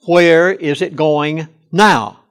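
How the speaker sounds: "now" set apart and hit hard; slow question, landing firmly on "now"; stress on NOW. Retold or imitated. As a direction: lean on the word "now". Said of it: "I emphasize the word now".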